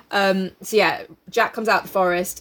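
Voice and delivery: normal talking voice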